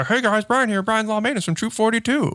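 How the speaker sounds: high, sunny sales pitch voice; a bright customer service voice, lifted way up; mock cheery intro voice. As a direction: put on a chirpy company greeting. Mock polite business intro.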